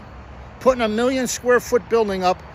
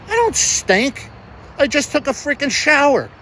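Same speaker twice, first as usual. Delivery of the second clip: insulted and letting it show; real indignant outburst; 6 semitones higher than usual. Real, not acted. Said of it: "sorry if I got a little bit excited".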